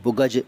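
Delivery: बुग़ज in two syllables, "bu-gaj"; hard g, extra vowel: बुग़ज as "bugaj"; common mispronunciation. Shown as a demonstration. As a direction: add a vowel after the g in बुग़ज and end on j. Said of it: "कुछ लोग".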